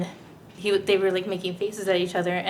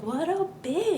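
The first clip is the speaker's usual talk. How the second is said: high, slow scornful aside; drawn-out sneer; mock disgust; 10 semitones higher, slower than usual. Retelling her own thought, slow high scornful aside. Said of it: "And I was like".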